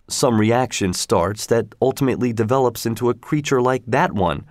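usual speaking voice

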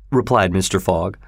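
usual speaking voice

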